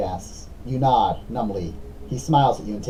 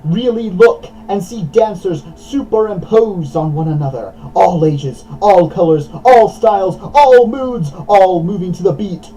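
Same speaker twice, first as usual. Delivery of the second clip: loud, raised, rhythmic narration; dramatic story narration; 6 semitones higher, 9 dB louder, slower than usual. A narrator's reading. Narrator among dancers worshipping the same beat, dramatic narration. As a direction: drive each phrase like a beat. Reads story aloud with intensity.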